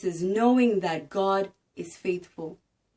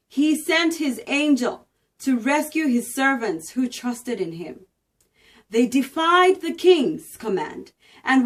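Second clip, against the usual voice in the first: higher, louder storytelling read of scripture; dramatic Bible reading; 4 semitones higher, 6 dB louder than usual. A narrator's reading. Reading Daniel aloud to viewers, dramatic proclaiming.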